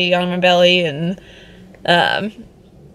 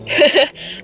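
laugh; 7 semitones higher than usual. Real, not acted. Laughing at the surprise.

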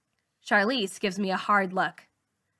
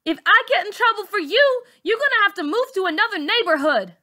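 very high, loud, fast threat; shrill threat; 11 semitones higher, 6 dB louder, faster than usual. Acted. Bully threatening the girl in front of the teacher, very high and loud. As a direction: scare her into silence. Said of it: "Char is saying".

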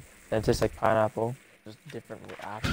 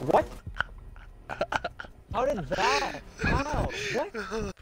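squeaky disbelief; 11 semitones higher, 4 dB quieter, much slower than usual. Real, not acted. Drone damage confusion.

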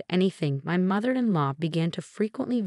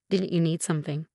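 ordinary delivery, nothing marked

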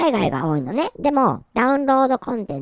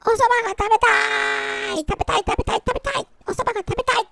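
whiny craving; 8 semitones higher than usual. Pretend. Hungry streamer whining for soba, high and childish. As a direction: beg for soba like a spoilt kid.

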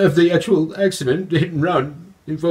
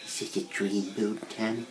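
swooning; 8 semitones lower, 13 dB quieter than usual. Acted. Swooning over Tommy.

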